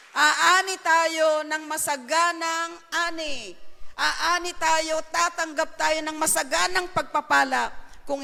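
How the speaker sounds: loud, slow, ringing sermon delivery to a standing crowd; full-force preaching. Acted. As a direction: preach it out, big and drawn out. Preacher exhorting congregation at full force. (malakas at mabagal na pangangaral)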